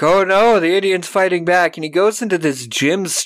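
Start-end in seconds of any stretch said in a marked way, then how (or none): 0.0-1.8 louder, higher mock alarm; hammed-up fright at the fight; mock panic. Townsfolk as Thunder fights back, louder mock panic. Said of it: "they're like".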